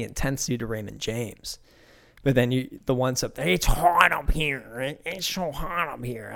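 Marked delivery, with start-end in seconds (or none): 3.5-6.3 slow, higher mock whine, trailing off; whiny fan. Fan in the upper deck whining about the heat, slow mock whine. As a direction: complain like a wilting fan.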